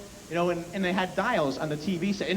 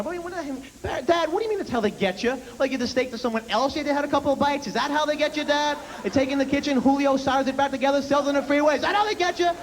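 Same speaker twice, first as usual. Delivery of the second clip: put-on high, fast exasperated kid voice; teen son shouting at dad over steak; mock scream at dad; 8 semitones higher, faster than usual. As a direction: yell back at your father in disbelief. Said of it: "I'm screaming at my dad".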